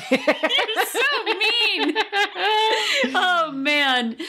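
high, laughing protest. Real, not acted. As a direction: laugh through the words. Laughing at a mean joke.

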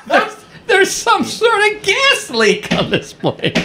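slow, very high strained complaint; distressed woman passenger. Retold or imitated. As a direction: imitate an alarmed passenger, squeaky and drawn out. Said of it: "And she goes".

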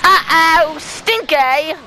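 high, loud mock alarm; teasing squeal. Real, not acted. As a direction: tease them in a sing-song. Teasing reaction.

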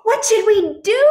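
high, loud, frantic question; panicked holler. A narrator's reading. Duck circles the egg, panicked holler. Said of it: "he hollered".

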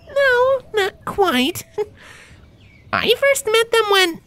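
very high griffin voice, still laughing; correcting her friend through a giggle; squeaky-high, laughing it off; Gabby, amused griffin. Acted.

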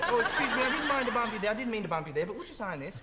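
giggling through a hammed-up "excuse me"; polite English fan. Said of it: "They're most polite when they mob you".

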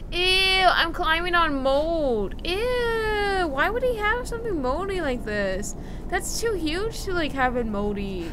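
high, loud grossed-out ew; recoil, loud and high; loud disgust. Real, not acted. Player climbing on mold, loud disgusted squeal.

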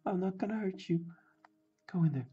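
high little voice cooing to a pet; playful coaxing. Pretend. Coaxes card into its sleeve.

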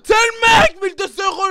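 loud, high, excited shout. Real, not acted. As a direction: yell it at your friend. Shouting at friend about price.

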